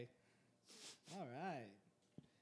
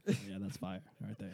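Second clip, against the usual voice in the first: chef in the mirror; 7 semitones lower, 6 dB quieter, slower than usual. Retold or imitated. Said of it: "doing it in the mirror".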